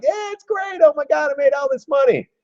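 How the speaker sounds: very high, giddy squeal of glee; gushing to mom. Retold or imitated. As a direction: gush like you just got rich. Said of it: "I'd call my mom and be like".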